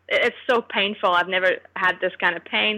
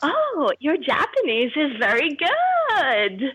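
higher, slow, sugary nurse; sweet nurse voice; 6 semitones higher, much slower than usual. Retold or imitated. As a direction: lay the compliment on sweetly and slowly. Quoting nurse compliment.

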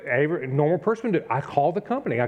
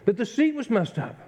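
retold complaint; 9 semitones higher than usual. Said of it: "And I said".

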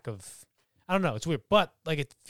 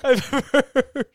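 high laugh-cracked voice; laughing while talking; 8 semitones higher, 6 dB louder, slower than usual. Real, not acted. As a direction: push words through giggles.

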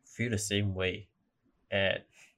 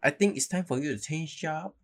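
put-on Uncle Roger accent; 7 semitones higher than usual. Fortune-teller advising a career change, mock uncle accent. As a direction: give advice high, in a put-on uncle accent. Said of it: "now I'm sounding like Uncle Roger".

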